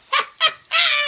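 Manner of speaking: squeaky delighted laugh; tickled high-pitched laugh; high giggle at own joke. Real, not acted.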